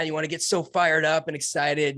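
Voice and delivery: ordinary delivery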